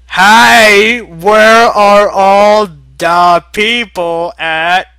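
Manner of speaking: high, loud, slow bewildered question; loud puzzled mech. Acted. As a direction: call out to the strangers. Character voiced calling out.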